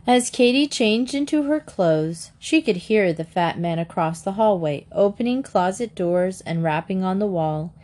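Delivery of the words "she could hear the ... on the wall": ordinary delivery, nothing marked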